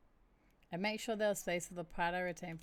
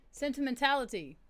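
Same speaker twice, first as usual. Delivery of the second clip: louder; 5 semitones higher, 8 dB louder than usual. Shown as a demonstration. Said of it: "What I said".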